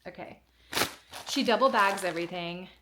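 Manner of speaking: ordinary talk, nothing marked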